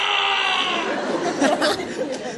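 a loud, high burst of laughter; real laugh at his own 'last cry' line. Real, not acted.

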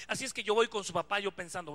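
ordinary delivery

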